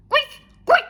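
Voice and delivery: very high, loud squeaks; shrieking mouse. A narrator's reading. World's noisiest mouse crying out, piercing squeaks. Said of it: "criait-elle".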